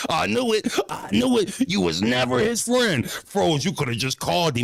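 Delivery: a hint of a smug accusing fan; mild mock fan gloating; toxic fan. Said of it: "toxic fan".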